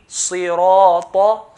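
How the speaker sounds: صراط drawn out slowly with a full mouth; slow heavy recitation. Shown as a demonstration. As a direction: stretch صراط for two counts. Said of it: "two counts".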